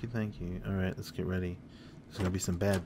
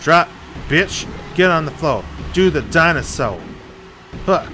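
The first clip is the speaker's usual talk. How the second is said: loud, high gamer yelling; shouting at enemies while shooting; excited shout; 6 semitones higher, 11 dB louder than usual. Real, not acted. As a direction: yell it out mid-fight.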